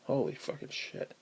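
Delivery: soft whispered exclamation; whispered curse. Real, not acted. Recalling the skunks, a soft disbelieving whisper. Exasperated aside.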